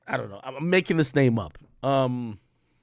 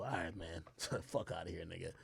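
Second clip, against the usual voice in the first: muttered dismissal; 8 semitones lower, 18 dB quieter than usual. Retold or imitated. Mock dismissive neighbor.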